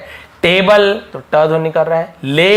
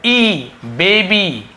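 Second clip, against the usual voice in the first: ई baby said slowly, ई drawn out; slow sound demo; 3 semitones higher, much slower than usual. Shown as a demonstration. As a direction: stretch the ई in baby. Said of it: "ई ध्वनि निकल रहा है". (ई को खींचकर धीरे से)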